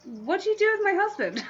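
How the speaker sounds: high, quick mock-incredulous question; mock bafflement. Wife to suddenly shrimp-obsessed husband, mock bafflement.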